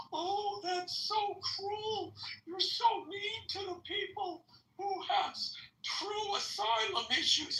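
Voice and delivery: hammed-up whine, mocking the critics; whiny Democrat voice. Mocking critics of the border policy, in a high whiny voice. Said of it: "That's my whiny Democrat voice".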